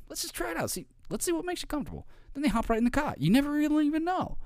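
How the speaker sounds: chirpy persuasive tone; high coaxing voice. Retold or imitated. Mimics upbeat coaxing voice.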